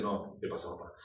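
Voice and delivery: put-on low, gruff teenage son talking back; son's deep voice. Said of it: "con su voz más gruesa que la mía". (voz grave del hijo, imitada con burla)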